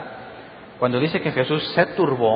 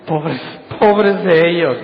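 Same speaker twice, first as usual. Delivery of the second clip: mock pitying; 3 semitones higher, 5 dB louder, slower than usual. Mock pity quote of Jesus.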